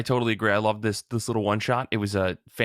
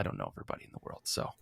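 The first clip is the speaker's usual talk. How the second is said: fast whispered aside; 13 dB quieter, faster than usual. Real, not acted. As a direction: throw it away in a whisper. Self-deprecating aside.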